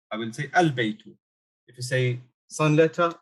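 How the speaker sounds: usual speaking voice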